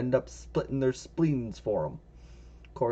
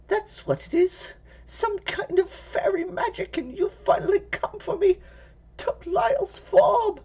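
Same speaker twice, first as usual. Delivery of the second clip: put-on high, trembling old woman cry; frightened old lady. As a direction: panic like a scared granny. Old lady panics about fairies.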